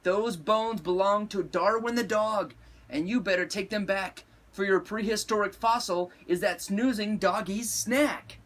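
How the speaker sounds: declared. A narrator's reading. Mama orders her kitty to return bones, scolding. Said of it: "declared Mama Junebug Jones".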